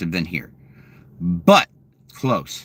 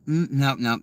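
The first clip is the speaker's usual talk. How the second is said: usual speaking voice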